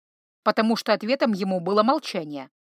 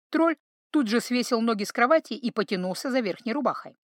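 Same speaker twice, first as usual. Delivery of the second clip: ordinary delivery, nothing marked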